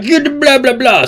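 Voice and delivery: high mock-yelled gibberish; Joe Biden. President yelling down everybody's worries, mock yelling. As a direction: squawk over the objection in a caricature. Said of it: "He's yelling at everybody".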